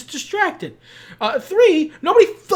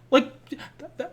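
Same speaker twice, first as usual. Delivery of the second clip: ordinary talk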